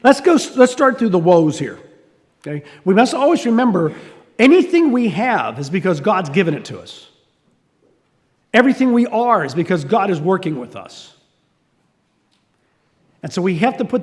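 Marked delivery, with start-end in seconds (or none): none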